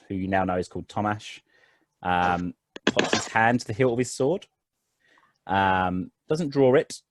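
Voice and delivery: normal talking voice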